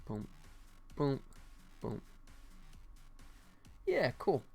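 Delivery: ordinary talk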